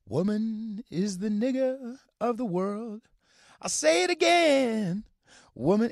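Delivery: high, slow, loud and grandiose; mocking a vapid, arrogant pronouncement. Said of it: "stupidly, profoundly vapid and arrogant and condescending".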